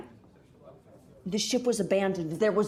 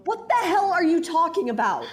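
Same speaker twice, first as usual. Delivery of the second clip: exasperated outburst; 8 semitones higher, 3 dB louder than usual. Acted. Frustrated outburst at the Doctor.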